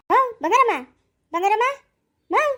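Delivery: calling out for Bangaramma loudly. Acted. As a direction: call her from afar.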